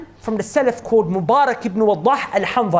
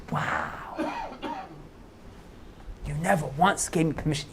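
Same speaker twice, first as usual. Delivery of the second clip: breathless, softer amazed echo; stunned master; 5 semitones lower, 10 dB quieter than usual. Retold or imitated. The master, stunned by his gardener's honesty, speaks in awe. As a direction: murmur it in awe.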